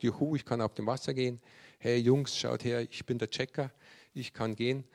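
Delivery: normal talking voice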